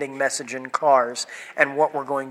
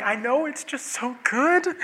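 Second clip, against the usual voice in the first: teary confession; 9 semitones higher, 5 dB quieter than usual. Retold or imitated. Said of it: "And I'm like".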